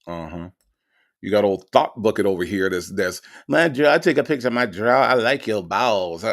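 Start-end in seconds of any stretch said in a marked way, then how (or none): 3.5-6.2 lightly mock her dumb-sounding boast; a touch of dumb-sounding mimicry; mocking dumb imitation. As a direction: mimic her as sounding foolish, drawing out the ending. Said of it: "Shit sounds so damn dumb".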